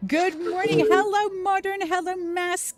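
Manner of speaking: high sing-song greeting. Real, not acted.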